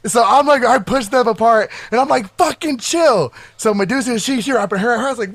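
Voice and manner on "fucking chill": higher-pitched retold order to chill; mildly heated, pitched-up command; exasperated command. Friend breaking up a fight, exasperated command.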